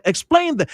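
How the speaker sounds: sharp high emphatic demand. Real, not acted. Radio host demanding officials explain, emphatic. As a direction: insist they explain it, hitting explain hard.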